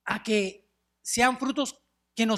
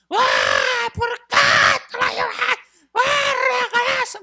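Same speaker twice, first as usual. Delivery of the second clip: high, loud anguished shriek, crying out; wailing scream; 7 dB louder than usual. Retold or imitated. Neighbors scream at the taken old woman, wailing. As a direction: wail it like mourners losing their grandma. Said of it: "y todos gritando".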